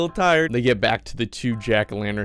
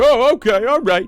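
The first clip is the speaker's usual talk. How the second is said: very high, loud acted reply; startled kids; 14 semitones higher, 8 dB louder than usual. Kids answering the demanding pumpkins, startled. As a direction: blurt it high, hurriedly giving in. Kids reacting to shouted orders.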